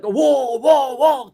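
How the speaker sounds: loud, heavy imitated woofs; big booming mock barks; big dog bark. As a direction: woof loudly like a big dog. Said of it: "his barking is very deep".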